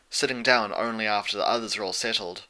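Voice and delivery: ordinary delivery, nothing marked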